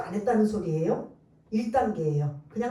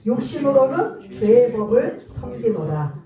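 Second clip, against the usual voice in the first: loud, steady verse read; scripture reading; 6 dB louder than usual. Acted. Pastor leading the church in reading verse twenty-five, loud. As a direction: proclaim the verse in unison. Congregation reads verse aloud.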